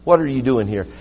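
low, soft voice of God asking gently; still small voice. A narrator's reading. Preacher voicing God softly to Elijah in the cave, still small voice. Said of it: "in that still small voice".

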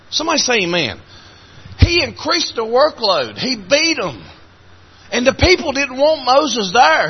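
high-pitched, driving sermon delivery; fervent preaching. Real, not acted.

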